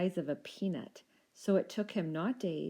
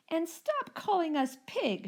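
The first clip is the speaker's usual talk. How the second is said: much higher, slow indignant squeak; indignant dino shout; 11 semitones higher, 4 dB louder than usual. A narrator's reading. First little dino shouting at the Rex, high and indignant. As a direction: protest hotly, drawing it out. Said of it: "shouted the first little dino".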